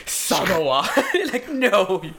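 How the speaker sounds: high, loud, cracking up; laughing. Real, not acted. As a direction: laugh through the word. Reading the wrong label, laughing.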